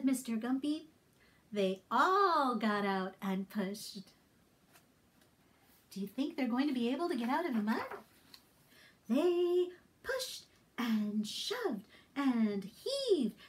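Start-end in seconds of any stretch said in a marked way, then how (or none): none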